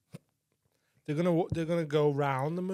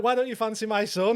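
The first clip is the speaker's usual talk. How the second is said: higher, louder mock indignation; high mock indignant dad; 7 semitones higher, 4 dB louder than usual. Sketch father pushing his son on a stranger, mock indignant. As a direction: play the offended father squeaking it out. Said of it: "He's like".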